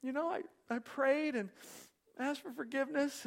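lightly voiced young woman, high; a hint of her pleading tone; imitating Ashley. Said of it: "And she's like".

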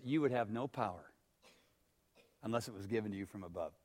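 low, soft, unruffled reply; very calm. Retold or imitated. Jesus answers Pilate's threat, very calmly, low and soft. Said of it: "no, very calmly says".

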